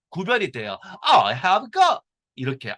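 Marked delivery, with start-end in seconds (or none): 1.1-2.0 emphatic British accent, higher and louder; British delivery with each word distinct. Shown as a demonstration. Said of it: "액센트가 영국 영어는 강하니까".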